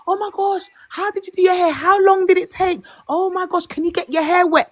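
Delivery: high, mock-fascinated questioning; gushing co-worker imitation. Co-worker quizzing her about braids, mock gushing. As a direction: imitate a colleague cooing in amazement.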